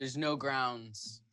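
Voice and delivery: low, slow and quiet; soft, drawn-out objection; low protest. Acted. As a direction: say it slowly under your breath. Group protests the failed grade.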